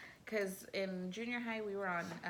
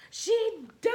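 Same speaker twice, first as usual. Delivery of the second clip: panicked shout; 14 dB louder than usual. Retold or imitated. Retold panic, thinks girl died.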